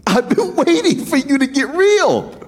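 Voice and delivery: high and bright, pushing him to get real; hyped-up Jesus egging Peter on, voice pitched high; eager Jesus voice. Retold or imitated. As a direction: cheer him on like an excited friend, up high with a laugh. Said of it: "As if he's saying".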